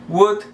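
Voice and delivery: "Would" with only a u sound; "Would" with the L silent; correct pronunciation. Shown as a demonstration. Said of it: "você só pronuncia o U".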